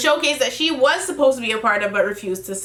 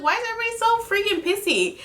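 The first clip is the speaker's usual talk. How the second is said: high, fast, fed-up question; high and fast annoyance; exasperated rant; 9 semitones higher, faster than usual. Retold or imitated. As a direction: rattle it off in disbelief. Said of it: "I was like".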